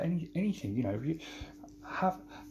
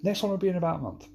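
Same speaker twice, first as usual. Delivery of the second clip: higher, louder, rushed mock promise; ironic promise; 7 semitones higher, 4 dB louder, faster than usual. Shown as a demonstration. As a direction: rattle off a promise nobody believes. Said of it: "we know what happens when I say that".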